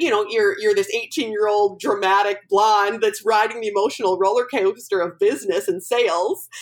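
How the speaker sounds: usual speaking voice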